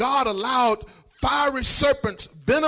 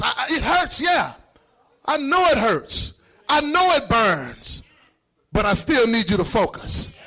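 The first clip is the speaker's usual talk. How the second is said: emphatic preaching. Real, not acted. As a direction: exhort the congregation in short, heavy bursts. Preacher cries out to congregation.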